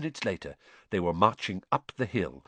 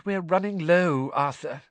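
sighed; 9 semitones higher, 3 dB louder than usual. A narrator's reading. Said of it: "she sighed".

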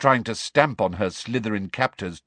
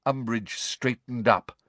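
plain reading voice

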